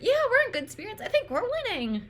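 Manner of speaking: mock cheerful heroes. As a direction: play the team's naive confidence. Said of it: "they're like".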